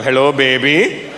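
put-on low, slow pickup line in an Indian accent; would-be seducer with Indian accent. Man flirts in a club, slow Indian accent. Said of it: "it's not gonna help you".

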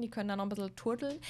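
normal talking voice

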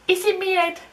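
put-on high lightly Australian accent. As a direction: slip into an Aussie twang. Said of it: "in that accent".

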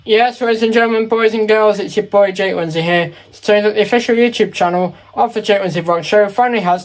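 put-on host it like kids TV; booming kids show opener. Hosts a mock TV show opener.

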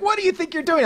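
a shop cop calling out a thief; cop challenge. Retold or imitated. Cop confronts Noelle.

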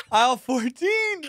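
high, slow mock reply through laughter; mock echo, laughing; laughing imitation. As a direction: laugh out the line.

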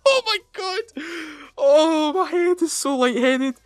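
high, squealed exclamation easing down; reeling and lightheaded, voice way up high; giddy overwhelm. Real, not acted. Player lightheaded after celebrating, giddy high exclaiming.

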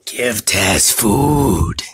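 very slow, growly Taz grunt; slow, beastly cartoon devil demanding food; Tasmanian Devil voice. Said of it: "that was Taz".

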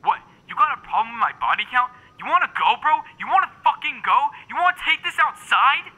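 high, loud picking a fight; angry. Acted. Picking a fight over insult.

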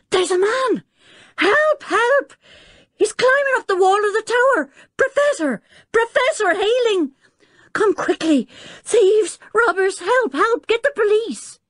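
high panicked cries for help; terrified screaming. Acted. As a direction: shriek for help in terror. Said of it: "She gave a loud scream".

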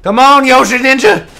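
urging on. Real, not acted. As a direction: urge your teammate on out loud.

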